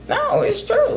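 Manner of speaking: suddenly very high and soft; changed voice. Real, not acted. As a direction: insist in a soft, high little voice. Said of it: "Why the voice change?".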